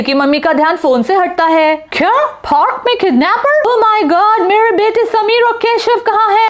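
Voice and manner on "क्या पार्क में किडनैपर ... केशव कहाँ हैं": much higher, alarmed mother's voice; rising alarm as it sinks in; panicked mother. Acted. Mother learns a kidnapper is in the park, high panic.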